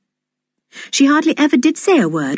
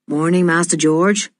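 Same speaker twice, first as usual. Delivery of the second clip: lightly voiced fisher boy. A narrator's reading. Fisher boy greets George.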